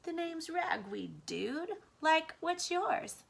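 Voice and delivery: high, laid-back surfer mouse; a slow, casual dude voice pitched high; cool mouse. A narrator's reading. Rescuer mouse introducing himself to Blinker, casual dude voice.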